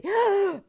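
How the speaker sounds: loud, high theatrical gasp; mock gasp. Host reacting to an innocent request, mock gasp. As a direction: gasp in exaggerated shock.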